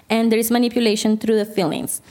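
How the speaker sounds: ordinary talk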